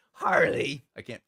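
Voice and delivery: usual speaking voice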